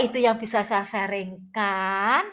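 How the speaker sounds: ordinary talk, nothing marked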